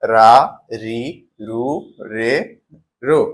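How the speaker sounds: "রা, রি, রু, রে, রো", each sounded out separately; syllable pronunciation. Shown as a demonstration. Teacher reciting the ra line for learners, syllable-by-syllable pronunciation of "রা, রি, রু, রে, রো". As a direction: sound out "রা, রি, রু, রে, রো" one by one with pauses. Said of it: "বর্ণগুলোর উচ্চারণ কি দাঁড়াচ্ছে".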